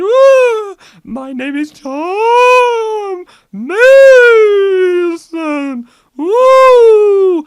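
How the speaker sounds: high, loud, slow wailing ghost; stock ghost voice. Shown as a demonstration. Mocking how ghosts talk, slow wailing ghost voice. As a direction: wail it like a cartoon ghost. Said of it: "I'd be talking like this. I wouldn't be going".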